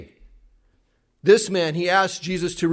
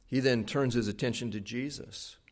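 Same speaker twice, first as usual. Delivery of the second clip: ordinary talk, nothing marked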